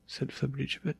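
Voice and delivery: plain reading voice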